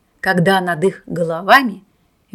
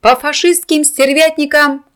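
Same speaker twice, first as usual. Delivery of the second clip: slow, loud firing order; command; 6 semitones higher, 5 dB louder, slower than usual. A narrator's reading. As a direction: give the order to fire. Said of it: "скомандовал он".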